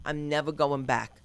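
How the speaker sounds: ordinary talk, nothing marked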